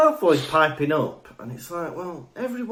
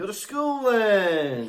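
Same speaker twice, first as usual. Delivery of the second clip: mimicking the co-host; 6 semitones higher, slower than usual. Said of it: "People do impressions of me".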